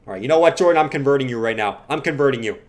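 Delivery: ordinary talk, nothing marked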